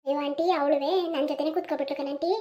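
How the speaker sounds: put-on meek teenage girl making excuses; sheepish girl. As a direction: shrink under the aunt's questions. Girl answering aunt sheepishly.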